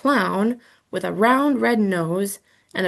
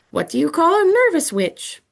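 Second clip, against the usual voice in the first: higher, louder spooky skeleton voice; strange high-pitched voice; 7 semitones higher, 4 dB louder than usual. Acted. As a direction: ask like an eerie pumpkin skeleton. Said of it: "in a strange, high-pitched voice".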